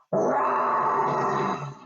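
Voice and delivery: long rasping snow leopard roar; big roar. Pretend. Presenter roaring with children like a snow leopard, big roar. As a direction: pretend big-cat roar, all rasp. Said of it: "Shall I make a big roar?".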